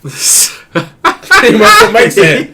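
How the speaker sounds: words stretched out through laughter; amused, drawn-out admission; laughing. Real, not acted. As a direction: laugh your way slowly through it.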